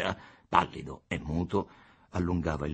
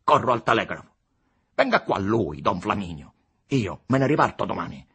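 raised, louder agitated outburst; convulsed, agitated; 4 semitones higher, 8 dB louder than usual. A narrator's reading. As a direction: fire off threats to leave, worked up. (sfogo convulso e agitato)

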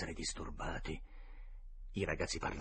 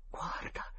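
whispered "Guarda"; hushed whisper of "Guarda"; 6 dB quieter than usual. Acted. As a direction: barely breathe "Guarda". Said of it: "sussurrò Tom".

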